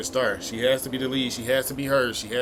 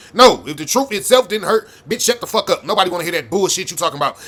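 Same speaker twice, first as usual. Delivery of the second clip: usual speaking voice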